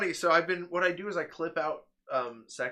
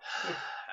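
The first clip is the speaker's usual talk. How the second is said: wordless resigned exhale; long breathy sigh; imitated sigh; 2 semitones higher, 5 dB quieter than usual. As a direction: mimic someone giving in with a sigh. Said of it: "You just go".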